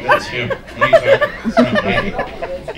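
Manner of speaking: high cartoon voice insisting it's someone else; squeaky mock denial. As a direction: play a panicked squeak shifting the blame. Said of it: "I'd be like".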